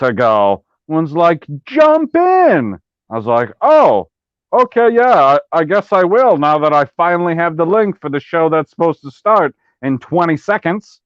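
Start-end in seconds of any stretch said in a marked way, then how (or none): 1.6-2.8 high, sing-out mimicry of a summons; mock urgent call. Co-host mimicking the host's last-minute invite, mock urgent. 3.6-11.0 high mock-surprised start, easing into peeved sarcasm; sarcastic compliance. Co-host griping to host about the late link, sarcastic.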